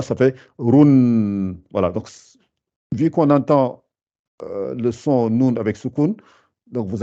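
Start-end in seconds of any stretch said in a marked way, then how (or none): 0.0-1.5 very slow run with the n held; drawn-out run. Shown as a demonstration. Teacher demonstrating double damma, drawn out. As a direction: stretch run slowly, lips rounded. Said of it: "le ra avec deux damma".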